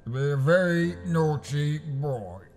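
understated Cockney gangster voice; Alfie Solomons impression. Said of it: "today's impressions tutorial is the enigmatic Alfie Solomons".

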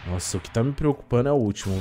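ordinary delivery

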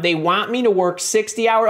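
usual speaking voice

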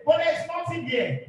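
normal talking voice

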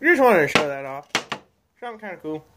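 talking around pipe stem; pipe in mouth. Real, not acted. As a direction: lips clenched on pipe. Seen 4: Pipe stem held in mouth while talking until 5.3.

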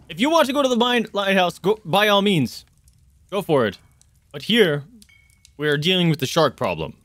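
frustrated captain, starting high; a little frustrated. Acted. Captain putting the shark before the lighthouse, a little frustrated. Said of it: "a little, uh, a little frustrated".

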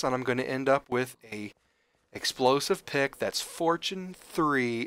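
ordinary delivery

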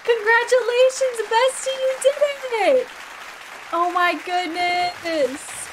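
high, drawn-out cheer; excited congratulations. Real, not acted. Streamer applauding a bestie's Skull Cavern win, excited. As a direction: celebrate them, stretching the last words. Applauds friend's game achievement.

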